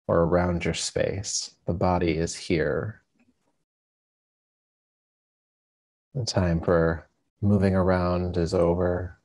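calm, unhurried meditation guide with long pauses; guided meditation. Acted. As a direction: ease listeners into stillness, leaving space between phrases.

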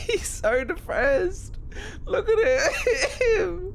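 high, slow mocking pity for the sad guy. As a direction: coo at him like a pitiful pet. Mocking the character on screen.

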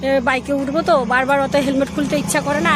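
ordinary delivery

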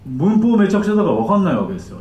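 ordinary delivery

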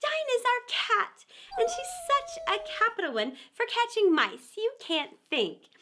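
high, bright, chattering on; eager. Acted. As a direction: gush about her pet. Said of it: "Alice replied eagerly".

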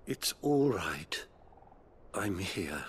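calm him, gently; comforting. Acted. Priest soothing the dying bearer at his side, gentle reassurance.

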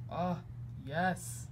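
ordinary talk

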